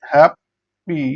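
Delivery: Happy split in two, pause between beats; syllable by syllable. Shown as a demonstration. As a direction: break happy into hap, then pi. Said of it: "syllable অনুযায়ী ভাগ করি".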